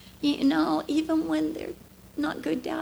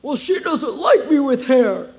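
amused dad; 9 dB louder than usual. Retold or imitated. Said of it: "My dad goes".

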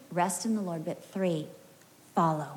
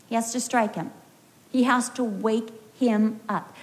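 normal talking voice

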